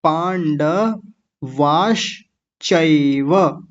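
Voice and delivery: पांडवाश्चैव drawn out, श stopped short, चैव with full ऐ; slow recitation. Shown as a demonstration. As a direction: spell out पांडवाश्चैव with the voice. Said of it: "इतना खोल दिया मैंने".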